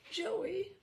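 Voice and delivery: high, thin mother's voice; a mother calling her son in a high, thin voice. Acted. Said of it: "she had said in her high, thin voice".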